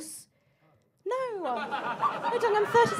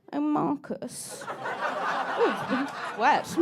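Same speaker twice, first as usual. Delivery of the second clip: tipsy flirty mum; much slower than usual. Pretend. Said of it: "they'll go".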